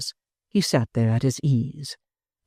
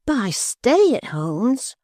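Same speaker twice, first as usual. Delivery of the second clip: high, very slow incredulous echo; Barnaby protesting in disbelief; high stretched out cry; cried out; 10 semitones higher, much slower than usual. Acted. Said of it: "cried Barnaby".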